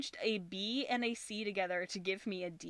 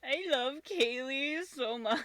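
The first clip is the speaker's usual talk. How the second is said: gushing; 5 semitones higher, 4 dB louder, slower than usual. Real, not acted. Gushing over cat.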